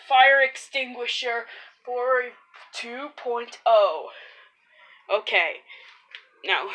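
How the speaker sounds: ad voice. Pretend.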